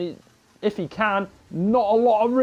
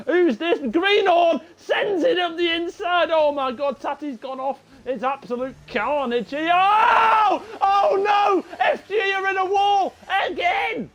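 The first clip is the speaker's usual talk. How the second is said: excited shouting; 11 semitones higher, slower than usual. Real, not acted. Commentator sees a multi-car crash.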